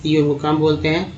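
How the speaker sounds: normal talking voice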